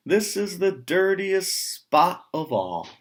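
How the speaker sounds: high, loud appalled exclamation; dismayed cry. Acted. Farmer's wife appalled by the muddy pig pen, crying out. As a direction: cry out in horror at the dirt. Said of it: "cries the farmer's wife".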